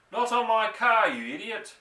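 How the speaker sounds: a high, loud furious bellow. A narrator's reading. Mister Hazel jumping up and down over his car, bellowing. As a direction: roar it in outrage. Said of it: "Mister Hazel bellowed".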